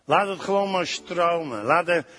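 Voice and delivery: soft, low worship voice that sinks to a hush; worship prayer. Acted. Preacher leading the congregation in worship, soft and low. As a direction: lead the worship gently over the music.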